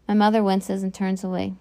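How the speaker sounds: ordinary delivery, nothing marked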